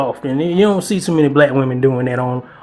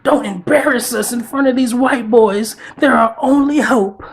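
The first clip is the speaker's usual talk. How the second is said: higher, put-on voice pleading with the group; mock them, voice raised high and put on; 6 semitones higher than usual. Vlogger mocking clubhouse women urging each other, high put-on voice. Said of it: "They be like".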